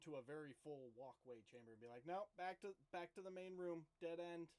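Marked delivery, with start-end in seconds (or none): 2.1-4.5 raised voice to a crowd; higher, louder in-character announcement; calling out. Acted. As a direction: call it out to everyone behind you. Calls to a full walkway of companions.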